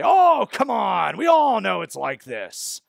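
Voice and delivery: loud, drawn-out mock exasperation; hammed-up "everybody knows" protest; mock brute-force dismissal. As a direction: imitate someone waving off the argument, high and loud. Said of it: "what I call a brute force tactic".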